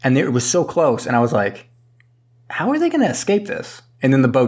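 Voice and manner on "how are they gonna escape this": retold disbelief. Viewer reacting to the ramming boat.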